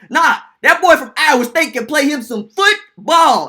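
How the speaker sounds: loud, high, drawn-out boast; excited dad. Retold or imitated. Son imitating his Seahawks-fan dad bragging, loud and drawn out. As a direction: imitate dad crowing about his player. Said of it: "He's like".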